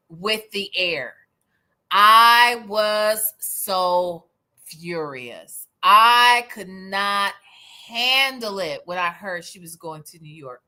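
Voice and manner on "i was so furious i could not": "I was so furious" stretched word by word; slow mock-dramatic emphasis. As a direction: lean hard and long on "I" in "I could not". Hammed-up account of her fury.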